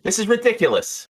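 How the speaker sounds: high-pitched, exasperated bartender; Jeannie. Acted. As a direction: complain in a high character voice. Game master plays bartender.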